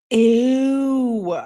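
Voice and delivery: high, drawn-out disgust. Real, not acted. Reader reacting to a girlfriend asking for money, disgusted. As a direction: stretch it out high in revulsion.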